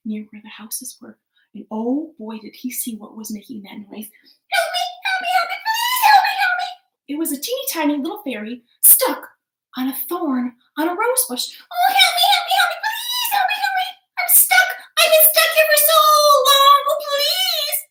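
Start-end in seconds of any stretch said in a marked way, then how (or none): 3.9-7.1 very high, loud squeaky cries for help; a tiny trapped fairy shrieking; high-pitched fairy wail; tiny fairy crying for help. Acted. 11.6-17.8 very high, loud squeaky cries for help; a tiny trapped fairy shrieking; high-pitched fairy wail; tiny fairy crying for help. Acted. Stuck fairy pleading.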